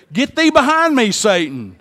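loud, high stern rebuke; rebuking command. Retold or imitated. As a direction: cast him off sharply. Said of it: "and Jesus said".

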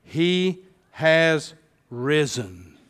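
each word slow and weighty, stress on has; slow solemn proclamation. Shown as a demonstration. Pastor to congregation, slow weighty proclamation. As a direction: lay out He has risen slowly, leaning on has. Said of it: "Those three words".

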